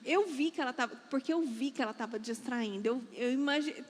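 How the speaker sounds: normal talking voice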